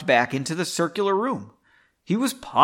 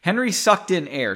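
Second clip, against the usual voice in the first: ordinary delivery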